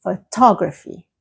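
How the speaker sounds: photography stressed on TOG; careful model photography with the beat on TOG; second-syllable stress. Shown as a demonstration. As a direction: hit the second part, pho-TOG-raphy. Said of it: "the stress moves to the second part".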